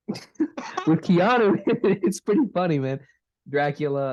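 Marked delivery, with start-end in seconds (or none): 0.1-2.8 chuckling through the words, a bit higher; laughing. Real, not acted. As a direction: laugh while you say it, amused. Laughing at Keanu's acting.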